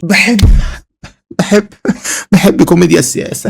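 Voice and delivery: loud, forced declaration; loud mock confession; loud declaring. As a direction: push it out as if forcing a confession. Repeated loud attempts at the line.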